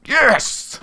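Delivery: very high, loud yes; triumphant shout. Real, not acted. Gamer seeing his keeper save the last penalty, triumphant shout. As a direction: explode with joy at the save.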